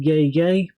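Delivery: a hint of the rapper's "yay, yay" ad-lib; imitating the ad-lib. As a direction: toss it off like the rapper's hype call. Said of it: "Did I hear that?".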